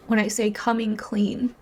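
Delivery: normal talking voice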